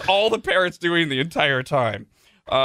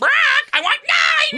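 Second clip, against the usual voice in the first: parrot squawk; 5 dB louder than usual. Acted. Parrot shouting back at the man, squawking. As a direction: screech it like a parrot. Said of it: "the parrot just repeats".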